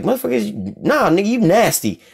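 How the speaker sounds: high, incredulous disgust, voice jumps up; preacher mocking his first reaction to hearing of it; disgusted retort. High disgusted mock retort.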